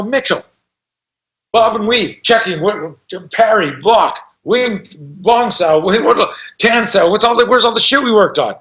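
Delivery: high-pitched, incredulous retelling; exasperated teacher. Retold or imitated. Self-defense teacher quizzing his student, exasperated. Retold teacher dismayed at forgotten techniques.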